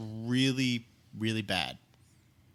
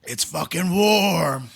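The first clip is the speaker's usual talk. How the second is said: slow, higher, drawn-out whine; exaggerated complaint; 6 semitones higher, 7 dB louder, much slower than usual. Real, not acted. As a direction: stretch it out in disgust. Complaining about beer.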